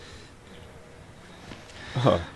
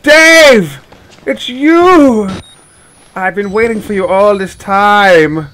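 high, loud hammed-up delight; overjoyed reunion cry; 8 semitones higher, 8 dB louder, slower than usual. Player finally finds Dave, overjoyed reunion cry. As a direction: call out to a long-lost friend.

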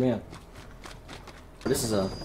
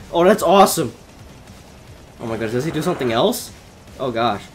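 ordinary talk

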